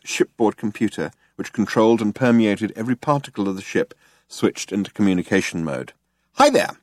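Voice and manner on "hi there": very high, perky greeting; bright. A narrator's reading. Shipboard computer greets Zaphod, relentlessly bright. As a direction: chirp it brightly, pitched way up. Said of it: "it said brightly".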